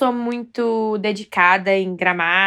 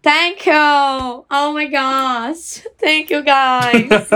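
mock gushing thanks; 3 semitones higher, 5 dB louder, much slower than usual. Hamming up accepting praise.

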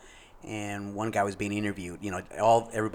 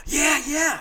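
much higher, louder, eager yeah yeah; excited yes; 5 dB louder than usual. Retold or imitated. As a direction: jump at the chance to be noticed. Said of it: "Felt like he got excited".

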